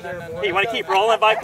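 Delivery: normal talking voice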